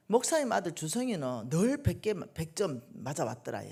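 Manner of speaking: normal talking voice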